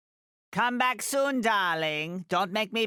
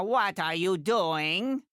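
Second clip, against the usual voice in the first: ordinary talk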